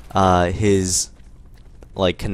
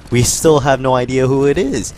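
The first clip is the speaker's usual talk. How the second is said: exasperated punchline; 5 semitones higher, 7 dB louder than usual. Retold or imitated. Punchline after "guess what?.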